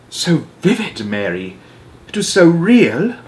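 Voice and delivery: high, regal, haunted by the dream; a queen reliving a vivid nightmare; troubled Queen. Acted. Queen tells Mary her giant dream, high troubled voice.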